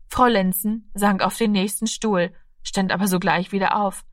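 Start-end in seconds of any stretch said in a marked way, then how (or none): none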